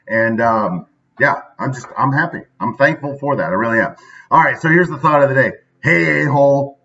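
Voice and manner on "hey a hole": mock insult. Mock greeting to viewers.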